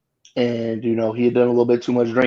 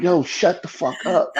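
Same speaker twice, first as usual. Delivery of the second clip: fed-up plea; 6 semitones higher, 3 dB quieter than usual. Retold or imitated. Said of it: "I'm just like".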